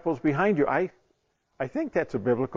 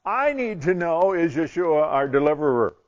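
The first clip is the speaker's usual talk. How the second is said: frustrated demand; 4 semitones higher, 5 dB louder than usual. Acted. Man demanding an answer from God, frustrated. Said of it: "Now I'm frustrated".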